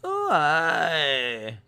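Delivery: put-on Scottish accent, drawn out; long, hammed-up Scottish cry. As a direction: do a mock Scottish greeting. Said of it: "can you try a Scottish accent".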